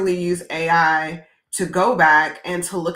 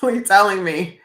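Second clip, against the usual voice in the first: usual speaking voice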